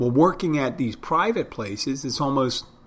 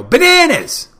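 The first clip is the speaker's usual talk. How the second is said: full-out high-pitched yell; 13 dB louder, slower than usual. Retold or imitated. High school friend yelling his catchphrase, wild loud shout. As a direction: bellow it high, as loud as you can. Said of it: "he used to yell".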